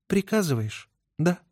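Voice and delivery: mild, distant indifference; indifferent. A narrator's reading. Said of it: "безразличным голосом спросила она".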